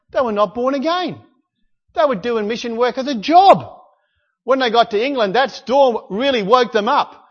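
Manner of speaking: ordinary talk, nothing marked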